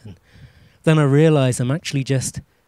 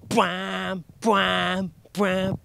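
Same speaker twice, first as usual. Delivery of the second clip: three softer imitated brass bwams; 3 semitones higher, 5 dB quieter, much slower than usual. Comedian mimics the Inception soundtrack, soft sustained horn noises. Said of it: "doing the [name] soundtrack".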